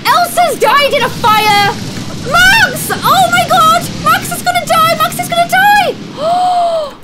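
high desperate screaming; panicked screaming. Real, not acted. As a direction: scream in horror. Elsa died, Max burning.

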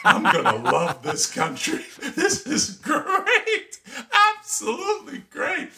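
high-pitched, laughing delight, stretching out the praise; gleeful excitement. Acted. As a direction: act out a thrilled kid, cracking up. Child thrilled by chaotic classroom.

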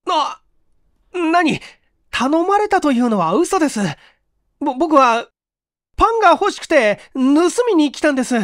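put-on high, loud, stammering young man; panicked stammer. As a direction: blurt out a frightened confession.

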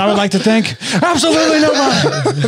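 high mock Irish speech; hammed-up brash fighter; Conor McGregor impression. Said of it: "he goes".

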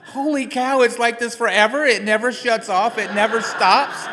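high, loud astonished cry; shocked disbelief. Retold or imitated. To the endocrinologist, learning the brain never stops, loud shocked. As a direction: blurt it out, floored by the news. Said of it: "And I'm like".

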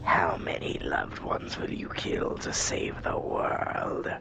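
slow, low, eerie whisper. Acted. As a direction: menace them in a slow whisper. Voices ominous villain line.

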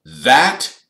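high, loud affronted word; stiff. A narrator's reading. Percy affronted by Ron's question, stiff. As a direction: land one word loudly and formally. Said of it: "he said stiffly".

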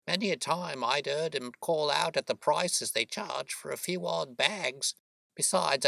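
high, grumbling working woman's voice; disapproving boatman's wife. Acted. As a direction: complain chattily in a high voice. Voices Mrs Smith complaining.

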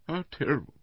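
high, plaintive little lament; Missus Bland, dismayed. A narrator's reading. Invalid wife hearing he was stabbed, plaintively dismayed. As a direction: sigh it out in delicate dismay.

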